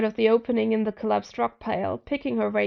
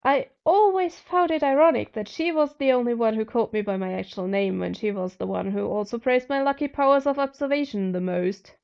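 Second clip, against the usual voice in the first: usual speaking voice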